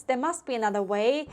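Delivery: usual speaking voice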